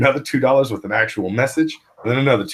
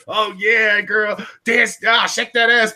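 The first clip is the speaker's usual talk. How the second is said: high, excited strip-club whooping; hammed-up club patron; 12 semitones higher, slower than usual. Imagining himself at a Canadian strip club, hammed-up hooting. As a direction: crow it high, hyping up the dancer.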